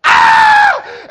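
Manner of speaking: very high, loud shriek; piercing mock-terror yell; panicked scream. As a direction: let out a hammed-up scream of terror.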